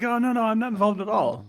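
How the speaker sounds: high, put-on voice of a guy faking innocence; lightly mocking falsetto denial; mock innocent denial. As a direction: play the liar protesting he's not involved. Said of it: "He was trying to be like".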